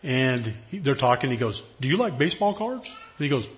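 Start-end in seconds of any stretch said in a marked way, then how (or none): 1.8-2.9 lightly voiced kid, higher and keen; eager little boy. Boy asks visiting speaker eagerly about baseball cards. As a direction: ask it like an excited eight-year-old.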